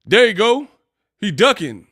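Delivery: high loud mock exclaim; loud mocking call. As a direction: call it out loudly. Mocking shout.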